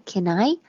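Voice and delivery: "Can I" run together as one word; linked words. Shown as a demonstration. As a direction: glide the n of "Can" straight into "I". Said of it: "把那个can和I连读起来". (把“Can I”连读成一个词)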